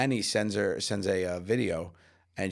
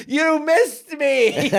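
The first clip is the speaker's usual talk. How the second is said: high, loud, long-drawn cry; child's wail; 9 dB louder, much slower than usual. Retold or imitated. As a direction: stretch it out like a kid calling back, hammed-up. Imitates son wailing at video's end.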